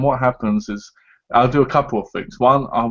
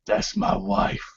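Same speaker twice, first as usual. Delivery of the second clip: soft, husky mock warning; possessive husband; 3 semitones lower, 6 dB quieter, much slower than usual. Husband warning off his wife's admirer, mock menace. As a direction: put on a quiet hard-man claim. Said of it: "I've gone".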